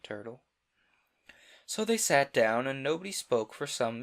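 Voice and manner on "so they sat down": usual speaking voice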